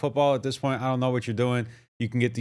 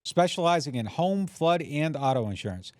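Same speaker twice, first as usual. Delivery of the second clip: bright, higher radio ad voice; ad read; 5 semitones higher than usual. Acted. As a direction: sell it like a local radio spot. Host reads sponsor ads.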